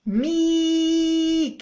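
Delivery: long held مي; drawn-out syllable mi. Shown as a demonstration. Teacher modelling the kasra syllable. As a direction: hold مي steady and clear. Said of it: "مي واش تصبح".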